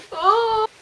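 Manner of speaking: high, loud drawn-out "oh"; anguished cry. Real, not acted. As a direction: wail one long high "oh". Reader's pained cry at the plot.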